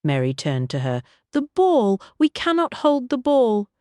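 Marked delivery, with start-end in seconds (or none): 1.3-3.7 high, loud near-shout; an upper-class lady on the edge of yelling; almost shouting. A narrator's reading. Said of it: "Lady Rose was almost shouting".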